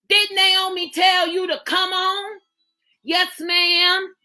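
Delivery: ordinary delivery, nothing marked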